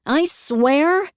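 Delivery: exasperated. A narrator's reading. Caroline exasperated.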